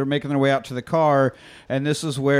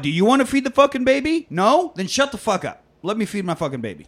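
mock rant; 6 semitones higher than usual. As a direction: challenge them, then rattle off the last line fast. Ranting at a critic.

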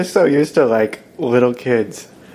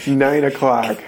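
ordinary talk, nothing marked

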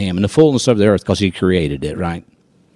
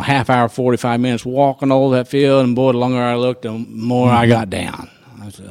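ordinary talk

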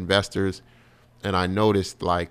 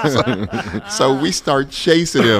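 laughing while telling; 5 semitones higher, 7 dB louder, slower than usual. Real, not acted. Laughing through the punchline.

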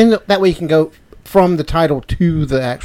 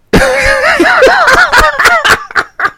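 very high, loud burst of laughter; 5 dB louder than usual. Real, not acted. Cracking up at own drinking joke, real loud laugh.

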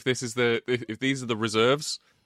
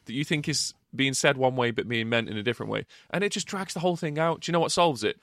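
usual speaking voice

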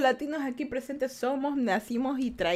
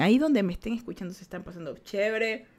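usual speaking voice

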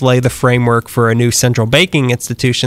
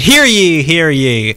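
town crier; 4 semitones higher, much slower than usual. Pretend. Opens final story announcement.

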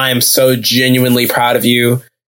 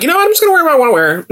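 careless celebrity; much faster than usual. Retold or imitated. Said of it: "Everyone pretty much was kinda like".

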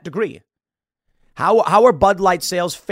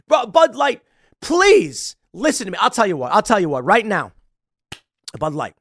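ordinary delivery

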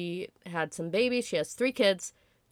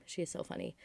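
whispering; 11 dB quieter than usual. Real, not acted. Repeats praise, suddenly unvoiced.

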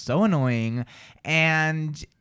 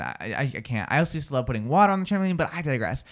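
ordinary talk, nothing marked

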